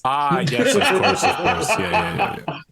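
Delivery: high laughing agreement. Real, not acted. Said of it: "realizing the joke, laughing".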